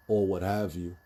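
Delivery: ordinary talk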